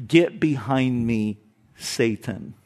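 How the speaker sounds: calm but cutting, drop the voice on Satan; stern Jesus rebuke. Retold or imitated. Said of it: "Jesus looks at Peter and says".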